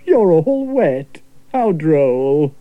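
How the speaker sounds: slow, prim amused clergyman; drawn-out dry remark of a vicar. Acted. Vicar watching them soaked, slow prim amusement. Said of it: "Said the vicar".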